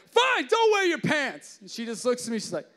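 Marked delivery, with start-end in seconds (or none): 0.1-1.6 high, slow, loud mock shout; a hammed-up exasperated yell; exasperated giving up. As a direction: throw up your hands and yell it. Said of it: "I'm like".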